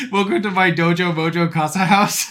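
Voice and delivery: playing Ken, puffed-up and showy; mock Ken bro voice. Fan quotes Ken's dojo line to friend, hammed-up bro voice.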